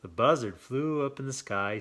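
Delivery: usual speaking voice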